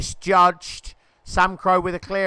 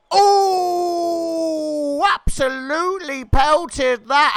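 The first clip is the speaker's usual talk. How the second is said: louder and higher, wowed by a powerful shot; excited near-miss; 8 semitones higher, 4 dB louder, much slower than usual. Real, not acted. Commentator reacting to a shot just wide, excited cry. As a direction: cry out at a thunderbolt strike.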